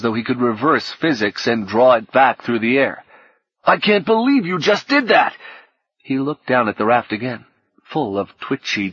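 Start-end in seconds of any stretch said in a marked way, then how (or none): 3.6-5.4 high, louder cry of horror; horrified shout. A narrator's reading. Fredericks shouting at Orlando for tossing the paddle, horrified. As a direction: yell it in disbelief and horror. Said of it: "Fredericks shouted in horror".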